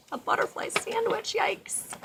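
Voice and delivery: high, whispered disgust; whispering. Acted. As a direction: breathe it out, mock disgusted. Disgusted aside about the sandwich.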